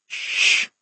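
long voiceless shush; hushing. Acted. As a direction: shush someone near a sleeper. Hushing over a sleeper.

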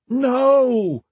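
high desperate cry; scream. Acted. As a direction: scream it in panic. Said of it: "Roan screamed in his head".

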